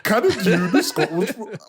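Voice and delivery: laughing try at Geordie; attempted Geordie. Trying Alan's accent and failing, laughing Geordie attempt. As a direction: fumble the Geordie line, laughing. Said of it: "that's impossible accent to do".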